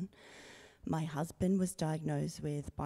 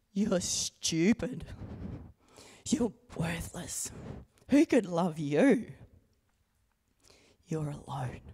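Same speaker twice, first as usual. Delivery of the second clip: mock harsh shout; 4 dB louder than usual. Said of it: "It shouted".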